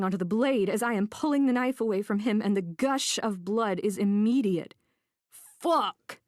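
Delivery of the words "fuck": sharp, high yelp of pain; pained yell. A narrator's reading. Cut man to the woman, a pained yell. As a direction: cry out as the blade cuts. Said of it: "he yells as he retracts".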